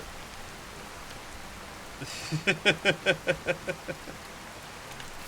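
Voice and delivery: quiet wheezy chuckle; breathy laugh. Real, not acted. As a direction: chuckle under your breath.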